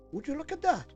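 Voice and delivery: usual speaking voice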